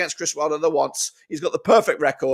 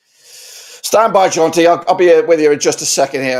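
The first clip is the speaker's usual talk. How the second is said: calling out; 11 dB louder than usual. Real, not acted. Calling out to guest during tech trouble.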